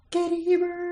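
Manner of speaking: high, announcer-style name call; hype man intro. Pretend. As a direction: introduce her like an arena announcer. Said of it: "Hype man".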